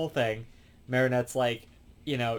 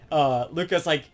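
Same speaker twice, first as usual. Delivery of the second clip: normal talking voice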